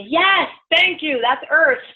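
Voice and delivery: high, slow, stretched-out otherworldly voice; mock alien voice. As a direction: play a cheerful voice from above. Voicing beings from above.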